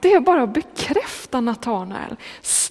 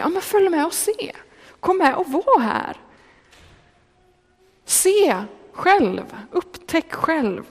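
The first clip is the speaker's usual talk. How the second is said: eager invitation; 5 semitones higher than usual. Pretend. Preacher voices inviting a friend.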